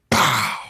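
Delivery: sharp, punchy "pow"; punch sound imitation. Son retelling punching his father, mimicked blow. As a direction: mimic a fist landing.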